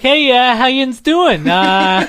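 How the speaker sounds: high, loud yinzer landlord; landlord's Pittsburgh accent. Retold or imitated. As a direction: greet the tenants like a Pittsburgh landlord. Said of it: "he'd be like".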